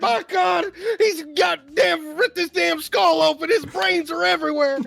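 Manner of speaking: high-pitched, hysterical ringside shouting; mock wrestling commentator. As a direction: call the carnage in hammed-up horror. Mock wrestling commentary on chair hit.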